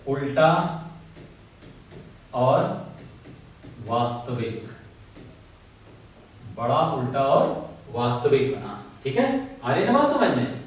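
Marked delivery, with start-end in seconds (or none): 0.1-4.7 each word set apart by long pauses; slow, spaced dictation to students. Shown as a demonstration. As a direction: spell it out slowly so they can write.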